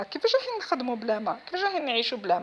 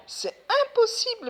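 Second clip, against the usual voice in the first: "امبوسيبل" cried out much higher and louder; exasperated cry; 6 dB louder, slower than usual. Real, not acted. To viewers about slaughtering without water, exasperated. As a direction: throw up your hands on "امبوسيبل". Repeats impossible, louder second time.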